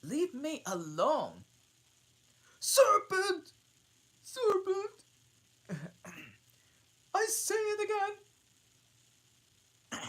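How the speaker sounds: high, loud screech; shrieking pigeon. Acted. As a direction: screech it like a frantic bird. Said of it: "said the pigeon".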